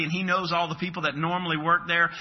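ordinary talk

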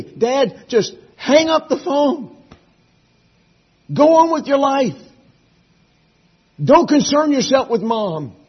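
high, loud, slowly spaced urging; imitating insistent son. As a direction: retell a son firmly telling Dad to let go. Quotes son urging him firmly.

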